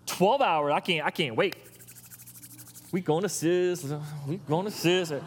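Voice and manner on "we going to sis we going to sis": movie quote. Retold or imitated.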